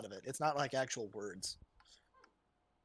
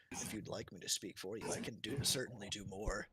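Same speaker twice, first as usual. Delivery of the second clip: very low, quiet demonic whisper; a deep voice murmuring inside your head; possessed; 10 semitones lower, 13 dB quieter than usual. Acted. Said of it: "You get a voice in the back of your head".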